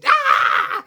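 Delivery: high excited shriek. Real, not acted. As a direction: let out a thrilled scream. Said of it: "Look at me, my voice".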